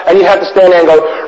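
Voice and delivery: ordinary talk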